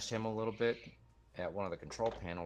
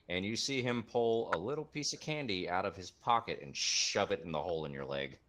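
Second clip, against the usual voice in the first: usual speaking voice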